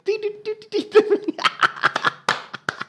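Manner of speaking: a long high-pitched giggle; giggling fit at the clip. Real, not acted. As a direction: burst into helpless giggles. Laugh alone.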